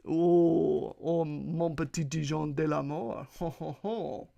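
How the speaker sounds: hammed-up French swoon with a chuckle; French accent. Shown as a demonstration. Podcaster showing off to listeners, hammed-up French accent. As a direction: croon mock French, then ho-ho. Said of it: "talk in a French accent".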